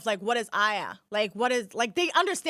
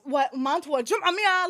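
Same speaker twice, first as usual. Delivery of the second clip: baffled reaction; 4 semitones higher, 4 dB louder than usual. Retold or imitated. Acting out her baffled reaction.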